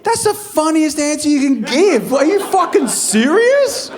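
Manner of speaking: mock outraged shout at the producer; incredulous outburst. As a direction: blow up at the silent room in disbelief. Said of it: "I'm like".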